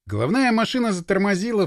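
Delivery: plain reading voice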